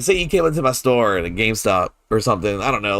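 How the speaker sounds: ordinary talk, nothing marked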